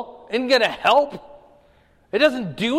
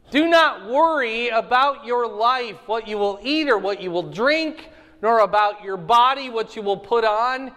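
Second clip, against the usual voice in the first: scripture reading; 5 semitones higher, 4 dB louder than usual. Acted. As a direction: proclaim the verses to the room. Reads scripture aloud to class.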